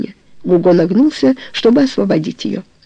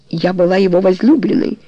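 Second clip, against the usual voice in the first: plain reading voice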